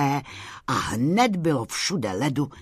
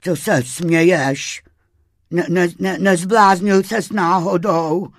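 loud, higher, stuttery worried voice; trembling crayfish in a fluster; shivering, frightened crayfish; 5 semitones higher, 8 dB louder than usual. A narrator's reading. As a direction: chatter it out anxiously. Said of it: "tetelil se Vavřinec".

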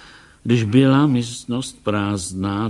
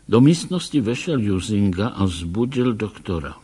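plain reading voice

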